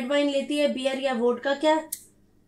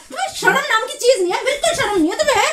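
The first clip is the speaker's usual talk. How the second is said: angry scolding; 7 semitones higher, faster than usual. Real, not acted. Wife scolding husband.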